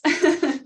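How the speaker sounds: real amused chuckle; light laugh. Real, not acted. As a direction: laugh lightly at your own remark. Chuckles after mentioning beautiful souls.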